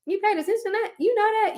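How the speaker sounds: acted surprise, pitch jumping high; high-pitched, marveling mock questions; pleasantly amazed. To a man who notices little things, acted amazement.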